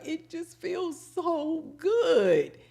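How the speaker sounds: high, slow mock-weepy wail; imitated tearful voice. Prison inmate having her hair washed, imitated weepy high voice. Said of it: "she literally starts crying".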